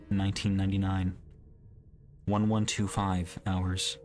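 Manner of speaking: ordinary delivery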